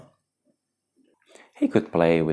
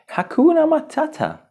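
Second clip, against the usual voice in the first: character voice; 5 dB louder than usual. Acted. Character says catchphrase.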